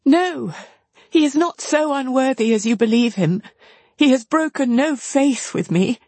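put-on high, earnest young woman's voice; high-voiced anxious protest; distressed sister. A narrator's reading. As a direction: defend him, pitched well up. Marianne defends her lover.

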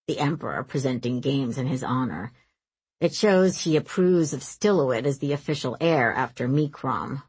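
low-pitched, confiding male character; grimacing prince. A narrator's reading. Ozorn explains Mother's presence to Varese, grimacing, low voice. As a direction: explain family politics in a low voice.

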